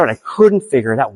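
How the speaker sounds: ordinary talk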